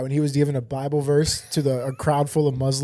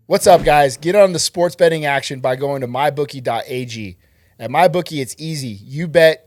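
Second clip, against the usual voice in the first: upbeat ad read, punchy and louder; sponsor ad read; 4 dB louder than usual. Acted. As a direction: sell it like a radio spot.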